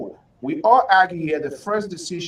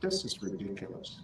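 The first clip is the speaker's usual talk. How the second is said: low, hushed grumble; exasperated aside; 7 semitones lower, 12 dB quieter than usual. Real, not acted. Host giving up on an unanswering guest, quiet and exasperated. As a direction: drop the voice well down in disbelief.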